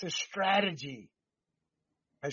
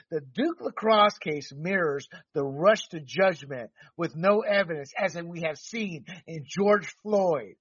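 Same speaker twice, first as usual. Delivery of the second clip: ordinary talk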